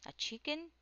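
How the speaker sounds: high, surprised cry of disbelief; all gasp the word in disbelief; astonished shout. A narrator's reading. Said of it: "they all shouted".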